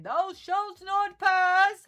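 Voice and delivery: loud, slow, high-pitched mock wizard's command; hammed-up wizard shout, stretched out; Gandalf. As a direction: bellow a drawn-out warning like a wizard. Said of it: "waving a bedpan at them like Gandalf".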